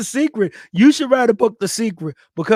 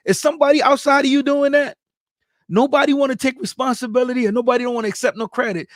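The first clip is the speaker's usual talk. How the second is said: usual speaking voice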